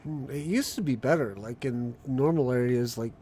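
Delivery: ordinary talk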